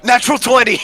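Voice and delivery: high, loud cry of triumph; excited cheer. Real, not acted. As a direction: shout it out after a lucky roll. Rolls a natural twenty.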